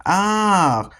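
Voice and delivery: high startled shriek; mock horror scream; screech of fright. A narrator's reading. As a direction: yelp in sudden fright. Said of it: "screeched Anxious Andrew".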